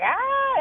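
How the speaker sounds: put-on short high yelp of a screaming child; imitated kid scream. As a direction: yelp like a kid throwing a fit. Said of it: "and screaming and all the things".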